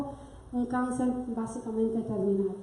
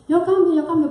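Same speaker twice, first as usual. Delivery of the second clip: louder and higher, mimicking the husband's insistence; mock insistent promise; 5 semitones higher, 8 dB louder than usual. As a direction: echo his hollow vow, pushing it up and out. Mocking husband's repeated promise.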